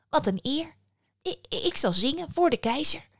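small bird honoured by the invitation; lightly voiced little nightingale. A narrator's reading.

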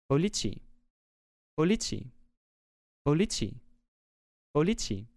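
identical, evenly spaced, parrot-like repeats; parroted repetition. A narrator's reading. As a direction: lightly mimic a parrot stuck on one word. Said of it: "alsof een papegaai de woorden voor haar herhaalde". (papegaaiachtig herhaald)

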